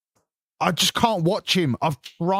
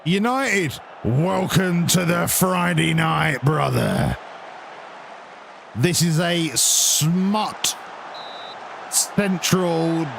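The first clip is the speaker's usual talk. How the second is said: drawn-out, hammed-up show opener; slow mock host welcome; mock announcer intro; slower than usual.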